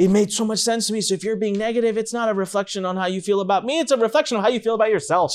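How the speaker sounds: imitating an earnest, worked-up explanation; excited realization. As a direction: gush it out like a sudden revelation. Retelling own amazed reaction to wife.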